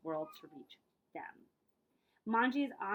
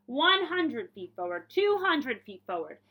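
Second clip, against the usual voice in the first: slow, louder counting of progress; dramatic counting; 3 semitones higher, 8 dB louder, slower than usual. A narrator's reading. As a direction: build the count like a triumph.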